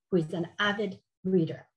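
ordinary talk, nothing marked

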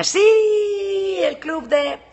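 high, loud drawn-out squeal; excited squeal. Real, not acted. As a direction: squeal with delight, long and high. Exclama emocionada por su club. (grito agudo de emoción)

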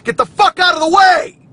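very loud, high-pitched yell; put-on road-rage shout. Driver putting on a show for listeners, mock-furious shout. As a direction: scream it at the car ahead, hammed up. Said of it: "that's me putting on a little show".

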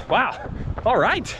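surprised. Real, not acted. Impressed by the passing train.

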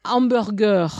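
"hamburger" said the French way, silent H, French R; French pronunciation. Shown as a demonstration. French speaker giving hard English loanwords, "hamburger" in French pronunciation. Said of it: "any French word that comes from English".

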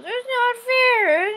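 a player mocking the kid who cries it's not fair; whiny kid. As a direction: wail it like a sore-losing little kid. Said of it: "that one kid that goes".

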